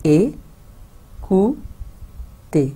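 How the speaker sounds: ए, कू, ते, each syllable apart with pauses; split into syllables. Shown as a demonstration. Teacher breaking écouter into syllables, paused. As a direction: chop écouter into three beats. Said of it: "तीन syllables में break कर देंगे".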